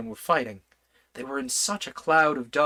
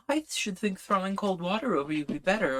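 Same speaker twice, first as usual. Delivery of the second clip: re-read in a lighter, higher voice; Alice voice, higher; 7 semitones higher than usual. A narrator's reading. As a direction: give Alice's line a higher, girlish lift. Same line reread, higher, other timbre.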